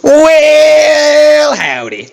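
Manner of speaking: long, high, loud Southern "well" dropping into howdy; southern accent. Shown as a demonstration. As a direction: stretch out a big cowboy greeting. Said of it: "this darn damn southern accent".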